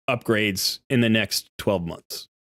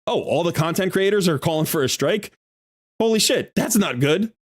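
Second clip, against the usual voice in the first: mock alarmed exec; 5 semitones higher than usual. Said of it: "They're gonna ask".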